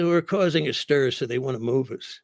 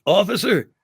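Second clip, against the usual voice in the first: raised, louder call for attention; 6 semitones higher, 6 dB louder than usual. Retold or imitated. Comic reenacts summoning the off-duty cop, calling out loudly. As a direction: call the officer over from another room.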